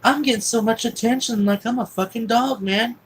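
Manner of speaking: high and loud, carried away; giddy excitement. Retold or imitated. Boy getting doted on by his new guardian, giddy excitement. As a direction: retell a kid's delight at being fussed over. Kid thrilled by all the attention.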